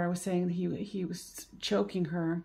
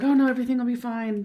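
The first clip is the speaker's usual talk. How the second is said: louder, higher, rushed false reassurance; mock cheery denial; 5 semitones higher, 8 dB louder, faster than usual. [name] telling himself it will all be fine, hurried mock cheer. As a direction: brush it off fast like nothing's wrong.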